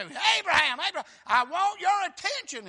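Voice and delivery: loud, high calling of Abraham; emphatic call. Acted. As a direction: call Abraham to full attention. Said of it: "It's an emphatic".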